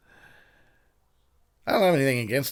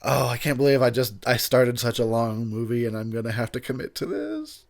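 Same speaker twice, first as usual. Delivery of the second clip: ordinary talk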